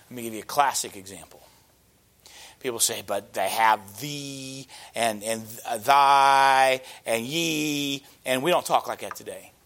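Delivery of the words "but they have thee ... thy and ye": voiced objection with long, held archaic pronouns; drawn-out objection. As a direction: stretch thee, thy and ye way out.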